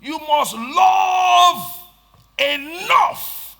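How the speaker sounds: long, slow, ringing shout on love; drawn-out shout. Real, not acted. Preacher shouting you must love to the congregation. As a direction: stretch out every word and shout it.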